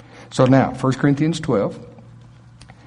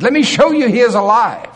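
loud, high preacher's cry; impassioned proclamation; 8 semitones higher, 5 dB louder than usual. Acted. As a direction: declare it triumphantly to a crowd. Preacher voicing Paul's proclamation at climax.

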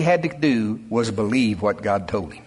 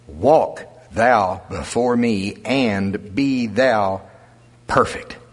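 very slow, lower, stretched out; solemn scripture reading; 5 semitones lower, much slower than usual. Real, not acted. Pastor reads God's command to Abram, very slow and solemn. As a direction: draw out the command word by word.

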